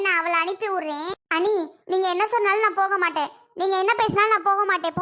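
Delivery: ordinary talk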